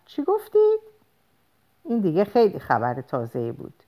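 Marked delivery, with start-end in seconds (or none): 0.1-0.8 very high-pitched surprised question; astonished. A narrator's reading. Caller hears surprising news about her husband, astonished. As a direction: ask in astonishment, voice leaping up. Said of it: "متعجب پرسیدم".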